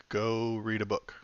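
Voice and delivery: slow, deliberate sign-off; trial catchphrase. Shown as a demonstration. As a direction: try out a sign-off line slowly. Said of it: "we'll experiment with different ones".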